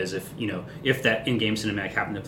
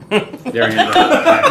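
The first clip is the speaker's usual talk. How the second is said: laughing; 12 dB louder, much slower than usual. Real, not acted. Joking along, laughing at joke.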